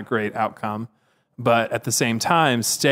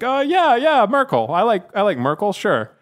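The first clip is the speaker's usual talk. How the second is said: caught-out candidate; 10 semitones higher, 4 dB louder than usual. Retold or imitated. Imitating Johnson's sudden recollection.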